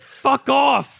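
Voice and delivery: high-pitched exasperated outburst. Retold or imitated. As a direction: act out a fed-up brush-off. Retelling a fed-up film character.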